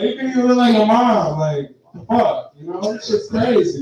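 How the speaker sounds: normal talking voice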